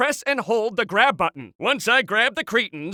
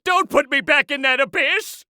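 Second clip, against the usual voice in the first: panicked plea; 5 semitones higher than usual. Acted. Begs not to be sent back.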